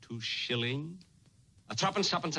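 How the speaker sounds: usual speaking voice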